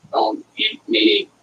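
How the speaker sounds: high, slow little pleading food voice; talking bread. Pretend. Cook voices the bread pleading with the eater, high and slow. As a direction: play the bread begging not to be eaten.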